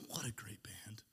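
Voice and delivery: whispered aside. Acted. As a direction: breathe it as a secret aside.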